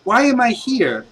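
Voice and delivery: put-on a boy waking up lost; lightly voiced dazed kid. Ricardo's son wakes and asks the heroes, lightly voiced dazed boy. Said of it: "He's like".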